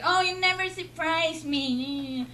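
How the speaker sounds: normal talking voice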